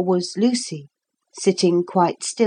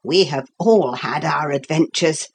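usual speaking voice

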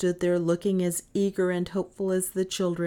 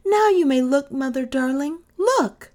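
put-on high, loud, joyful children's cry; excited cry; 8 semitones higher, 8 dB louder than usual. A narrator's reading. Children unveiling supper for their mother, crying out joyfully. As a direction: shout it out, the big reveal. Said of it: "the children both cried at once".

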